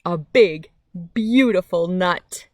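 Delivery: lively picture-book reading with big pauses; reading to children. A narrator's reading. Reading a picture book to kids on a nature channel, lively storytime voice. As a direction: read it like storytime for little ones.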